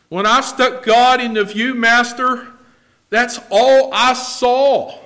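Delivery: very loud, high preacher's cry; full-force preaching. Real, not acted. Preacher testifying to what he saw of God, preaching at full force. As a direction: belt it out from the pulpit.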